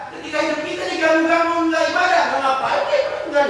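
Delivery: high, loud, fast sermon peak; preacher warns the congregation about disturbed worship, loud and fast; fiery preaching. Acted. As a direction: drive the point home at full force. Preacher rises to full force.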